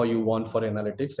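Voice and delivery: ordinary talk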